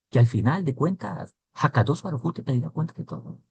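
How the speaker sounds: normal talking voice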